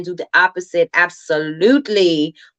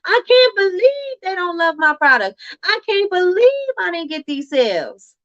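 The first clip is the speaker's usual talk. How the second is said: high mock-whine; high, mocking whine of excuses; whiny complaining voice; 9 semitones higher than usual. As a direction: imitate a sulky self-pitying seller. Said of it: "we will definitely be like".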